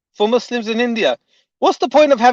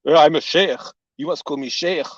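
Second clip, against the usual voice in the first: mock pompous sheikh; 6 semitones lower than usual. To viewers, parodying the sheikh in a low mock-pompous voice. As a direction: lightly parody a pompous cleric demanding his title. Mocking a self-important sheikh.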